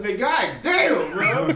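slow, loud groan of frustration, half laughing; exasperated outburst. Retold or imitated. Coworker retells slamming the box, loud slow fed-up groan. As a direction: stretch it out like you're fed up. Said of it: "I said".

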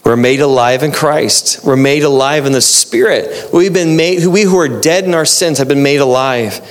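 usual speaking voice

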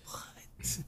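breathless whispered disbelief. Retold or imitated. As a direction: whisper it in mock shock. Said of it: "I'm like".

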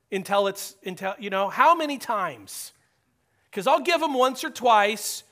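loud, pleading Peter, slowing hard on fool me once; exasperated Peter. Acted. Peter asking Jesus how many times to forgive, loud and exasperated. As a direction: play Peter fed up and bargaining for a limit.

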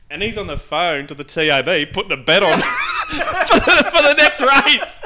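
near-shouting laughter at the absurdity; laughing, building to a shout. Real, not acted. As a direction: laugh out the punchline. Laughing about the bet.

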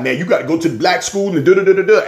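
high, fast mocking voice; mocking folks' advice.

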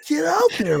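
high, loud, thrilled send-off; excited ranger. Retold or imitated. Ranger excitedly sending the regular golfer off. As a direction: cheer him out to the course. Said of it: "he got so excited".

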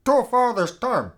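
loud angry dismissal. Acted. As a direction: order him out, furious. Throwing Robert out.

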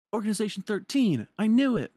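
high, loud triumphant exclaim; excited. Retold or imitated. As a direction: blurt it out like a eureka. Character exclaims in retelling.

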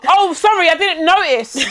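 high-pitched fake sorry; playfully over-sweet apology; mock apology. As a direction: put on an exaggerated apology, laughter creeping in. Acts out sarcastic apology to date.

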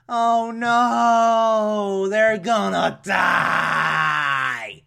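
high dramatic "oh no"; overplayed cliffhanger panic; mock alarm.

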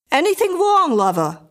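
loud, high start dropping on the last word; young woman calling. A narrator's reading. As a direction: call to your man from the next room. Young woman calls from the kitchen.

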